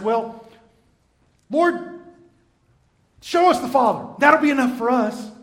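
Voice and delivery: eager plea. Acted. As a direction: beg loudly to be shown the Father. Philip voiced pleading with Jesus.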